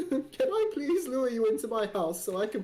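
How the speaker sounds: Mister Tumnus. Retold or imitated. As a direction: play a sweetly creepy faun. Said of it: "more like the goat from Narnia".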